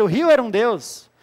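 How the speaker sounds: ordinary delivery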